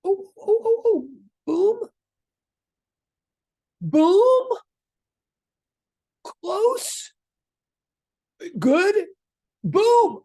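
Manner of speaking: high-pitched bursts of delight; thrilled teacher celebrating each answer; very excited. Real, not acted. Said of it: "I'm very excited".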